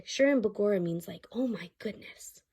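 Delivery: hushed storytime aside; whispered aside. Real, not acted. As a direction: lean in and whisper. Whispered explanation to child.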